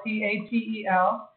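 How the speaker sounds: P A T E L said as separate letters; spelling it out. Shown as a demonstration. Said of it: "his last name is Patel".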